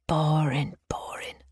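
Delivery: whispered, bored contempt; scornful whisper. A narrator's reading. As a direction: breathe it out with scorn. Narrator's private scornful aside.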